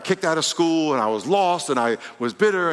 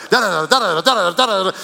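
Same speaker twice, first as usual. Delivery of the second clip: louder, rapid da patter; mock fervent praying; 4 semitones higher, 5 dB louder than usual. Preacher's playful take on the girl praying, loud patter. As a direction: imitate her praying straight at you. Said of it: "This is my interpretation".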